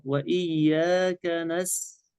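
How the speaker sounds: وإياك نس slow, ending on a clear hissed s; light-letter recitation. Shown as a demonstration. Sheikh models the verse, slow light letters. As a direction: hold "وإياك نس" light through the sin. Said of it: "الأول مرقق والثاني مرقق".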